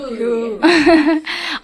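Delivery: real, amused laugh; spontaneous burst of laughter. Real, not acted. Laughs after wishing papa happy birthday.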